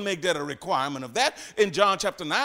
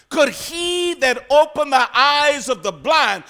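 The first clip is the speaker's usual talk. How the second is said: preached quote; 6 semitones higher, 10 dB louder, slower than usual. Retold or imitated. People's comment, preached loud.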